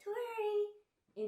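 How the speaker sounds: very high, put-on influencer squeak of a name. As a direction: sing out your name like an influencer. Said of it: "influencer voice".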